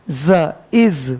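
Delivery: slow, drawn-out buzzing z sound. Shown as a demonstration. Teacher to students, demonstrating z: slow buzzing z. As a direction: sound out the voiced z slowly. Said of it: "तुम पढ़ना".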